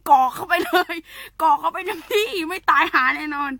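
high, hammed-up gleeful urging; mock gleeful. Teasing pill-lovers to gulp herbs, high mock glee. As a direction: egg them on like a joking friend. Joking about gulping pills.

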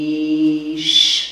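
put-on slow, drawn-out word for children; teacher spelling slowly; spelled out slowly. Shown as a demonstration. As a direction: stretch the word out for the class to spell. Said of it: "Betűzzük hangosan".

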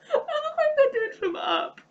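words through high laughter; laughing. Real, not acted. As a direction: say it while cracking up. Laughing at filter.

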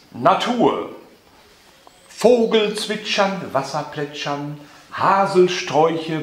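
slow, spaced-out poem delivery; poetry recitation. Acted. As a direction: recite in short, measured phrases with long pauses. Poet recites his poem at a reading.

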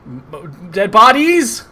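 ordinary talk

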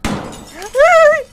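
piercing high scream of fright; sudden full-volume shriek; startled scream. Real, not acted. Player caught by a jump scare, startled scream.

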